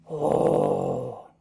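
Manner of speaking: very low, drawn-out oh; ominous low oh. Acted. Joy sinking into a very low dark oh. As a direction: drop it deep and ominous.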